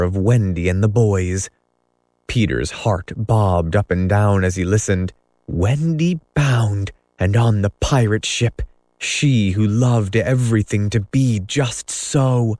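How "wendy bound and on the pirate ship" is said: usual speaking voice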